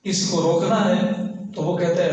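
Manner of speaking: ordinary delivery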